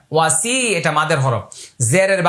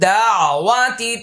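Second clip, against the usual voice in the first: slow, higher, drawn-out chant; dua recitation; 5 semitones higher, much slower than usual. Shown as a demonstration. Teacher recites the dua to students, slow melodic chant. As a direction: intone the prayer, lengthening every vowel.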